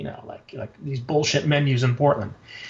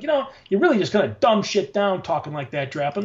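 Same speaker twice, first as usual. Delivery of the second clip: ordinary delivery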